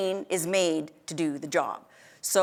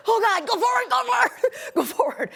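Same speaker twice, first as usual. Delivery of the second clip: high, loud laughing go forward; laughing panic; 13 semitones higher, 4 dB louder than usual. Retold or imitated. Near the edge, urging herself forward, laughing panic. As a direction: urge yourself on through laughter.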